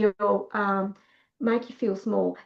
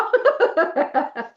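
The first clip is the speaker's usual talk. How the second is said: high, bubbling laugh; amused laugh; 5 semitones higher than usual. Real, not acted. Teacher amused by her own comeback, laughing. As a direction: burst out laughing at your own line.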